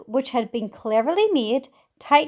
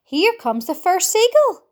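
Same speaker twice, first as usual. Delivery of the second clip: ordinary delivery, nothing marked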